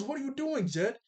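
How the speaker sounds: high-pitched retold protest; alarmed objection. Sokka stopping Jet from hitting the old man, alarmed objection. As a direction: voice jumps up to challenge him.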